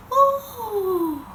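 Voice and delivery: high rising ooh; a startled swoop of a cry; ooh of falling. Acted. Drop falling as rain, a high startled ooh.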